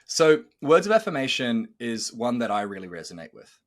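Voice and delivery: normal talking voice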